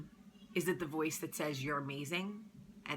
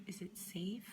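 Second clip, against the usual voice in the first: tiny unvoiced whisper asking permission; a scared little whisper; timid whisper; 3 semitones higher, 9 dB quieter than usual. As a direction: sadness peeking out, whispering. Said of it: "sadness wants to come out and is like".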